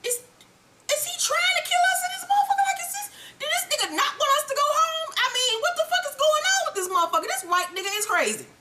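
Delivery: very high, frantic, near-crying mock panic; panicked disbelief. Recapper voicing Milan and Ghost horrified at Tommy, shrill panic. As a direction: squeal it like they fear for their lives. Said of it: "look at him like".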